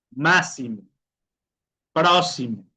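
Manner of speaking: "Máximo, Próximo" with plain s, "másimo, prósimo". Shown as a demonstration. Teacher giving examples, "Máximo, Próximo" with an S sound. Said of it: "sonido de una S normal".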